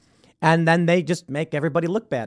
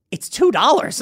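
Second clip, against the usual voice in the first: manager's reply; 7 semitones higher, 3 dB louder than usual. Retold or imitated. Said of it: "and the manager goes".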